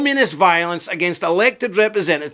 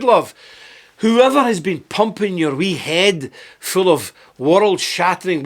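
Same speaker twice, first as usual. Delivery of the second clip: ordinary talk, nothing marked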